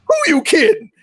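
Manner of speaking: mock old lady calling through the door. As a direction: pitch it up like a nosy old neighbour. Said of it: "and that one old lady's like".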